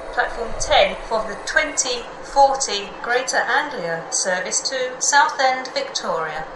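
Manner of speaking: tannoy over the platform; automated station PA voice; train station announcement. Real, not acted.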